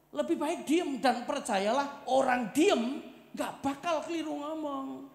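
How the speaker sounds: loud, high sermon proclamation; preaching at full force. Acted. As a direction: proclaim it to the whole church. Preacher exhorting.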